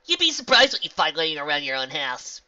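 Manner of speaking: loud, cheeky quip. Acted. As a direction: tease her with a grin. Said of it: "Rainbow quipped cheekily".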